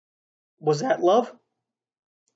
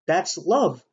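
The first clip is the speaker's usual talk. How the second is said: ordinary delivery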